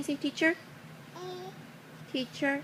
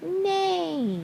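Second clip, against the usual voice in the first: high, long falling neigh; horse neigh; 7 semitones higher than usual. Pretend. Parent repeating the horse sound, high falling neigh. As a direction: stretch the neigh high and let it fall. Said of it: "said the horse".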